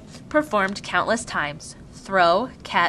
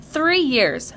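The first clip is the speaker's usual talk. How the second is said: high, emphatic statement of fact; stern, decisive mother; firm; 8 semitones higher, 4 dB louder than usual. A narrator's reading. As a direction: lay it down firmly to a child. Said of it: "Mother told her firmly".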